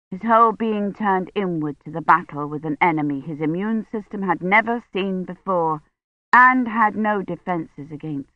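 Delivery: ordinary delivery, nothing marked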